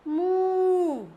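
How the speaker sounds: long ū vowel; long oo sound. Shown as a demonstration. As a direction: stretch the oo in मू. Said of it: "बड़े ऊ की मात्रा लगा दी".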